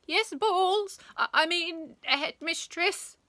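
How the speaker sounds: flustered, high, loud reply; startled teacher tripping over his words; calling back. A narrator's reading. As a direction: stammer a correction while calling up. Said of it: "he called back up".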